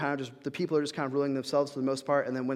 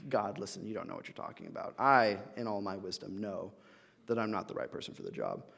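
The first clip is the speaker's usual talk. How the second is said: low, soft, smug know-it-all; mock condescension; 5 semitones lower, 5 dB quieter than usual. Moses lecturing God, low soft mock smugness. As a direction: talk down like you know better.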